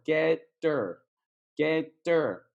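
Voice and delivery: "Get der" said slowly, "her" without its H; dropped H. Shown as a demonstration. Teacher showing learners the everyday form, dropped H in "her". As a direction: draw out "Get der", linking the T into "er". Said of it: "we don't really say the H sometimes".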